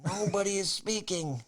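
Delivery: high, pointed reproach; appalled scolding. Retold or imitated. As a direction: spell it out to someone making a scene. Scolding the screamer in theater.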